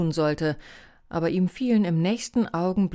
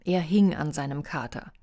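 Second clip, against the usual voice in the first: ordinary delivery, nothing marked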